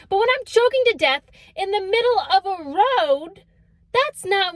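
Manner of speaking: rising indignation; indignant. Real, not acted. Indignant outburst.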